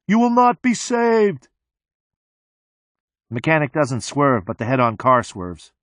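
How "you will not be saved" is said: screams. A narrator's reading. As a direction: scream it at the oncoming car. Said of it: "and the mechanic screams".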